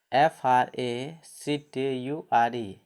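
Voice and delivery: slow spelling. Shown as a demonstration. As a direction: spell each letter out slowly for the class. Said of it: "अंत में यू R E है ना". (ज़ोर से, धीमे-धीमे spelling)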